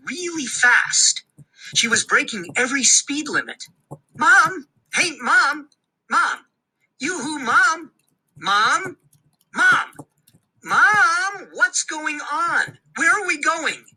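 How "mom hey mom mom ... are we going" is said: calling out. Acted. Boy in the speeding car calling to his mom, worried. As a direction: keep calling her, worried.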